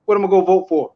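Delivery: imitating a dismissive voter.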